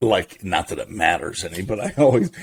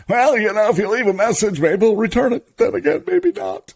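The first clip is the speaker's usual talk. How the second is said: high nasal small-town deputy; high-pitched goofy deputy voice; Barney Fife impression; 13 semitones higher, 3 dB louder than usual. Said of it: "he did it as Barney Fife".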